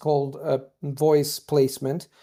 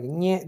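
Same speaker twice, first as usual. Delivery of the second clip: a whiny mocking "Nyeh" a little higher; 4 semitones higher than usual. Classmates mocking his new American accent, taunting nyeh. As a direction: imitate classmates' taunting nasal sneer. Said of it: "they were, like, doing this sound".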